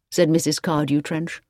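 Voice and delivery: ordinary delivery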